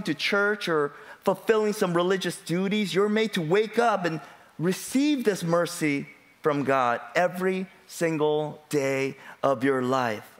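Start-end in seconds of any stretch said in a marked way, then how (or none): none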